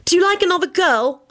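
put-on high, plaintive girl's question; hurt, high-pitched accusation; upset questioning. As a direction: ask it in a wounded wail. Upset Elizabeth questions her lover.

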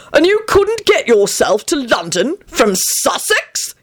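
high, slow incredulous outrage; bellowing. Acted. Arthur aggravated by the tragic tale, high incredulous bellow. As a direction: draw out the furious question up high. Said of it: "he bellowed".

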